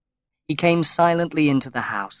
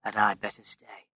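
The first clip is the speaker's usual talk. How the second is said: whispered; 3 semitones lower, 6 dB quieter than usual. A narrator's reading. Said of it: "he whispered".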